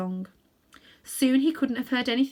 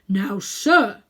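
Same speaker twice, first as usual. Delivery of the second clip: brisk, louder bulldog voice; businesslike bulldog; 7 dB louder than usual. A narrator's reading. The bulldog questions Uncle Andrew in a brisk, businesslike way. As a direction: address him like an officious bulldog. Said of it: "in his businesslike way".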